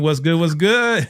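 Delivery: put-on playful European-accented greeting. Host greeting a guest from My Sweet Perspective, in a European accent. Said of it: "That, that European accent transcending".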